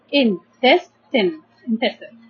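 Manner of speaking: "in, test, tin" then "intestine" blended together; syllables then whole word. Shown as a demonstration. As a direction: build the word from its parts. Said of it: "divide this word into three syllables".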